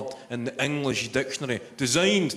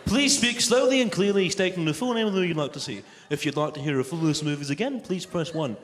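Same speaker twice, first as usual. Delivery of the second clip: automated booking line; faster than usual. Acted. Booking line repeats its instructions, mock automated voice. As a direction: imitate a recorded phone message on repeat. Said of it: "the automated cinema ticket booking line".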